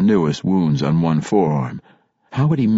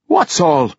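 higher-pitched questioning dwarf mother; raised, puzzled dwarf mother voice; 9 semitones higher, 4 dB louder than usual. A narrator's reading. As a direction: demand an explanation as the mother.

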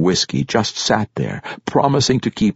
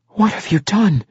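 high-pitched indignant demand; 14 semitones higher than usual. A narrator's reading. Young halfling confronting the barkeep, demanding. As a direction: demand an answer in a high youthful voice. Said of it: "he demanded".

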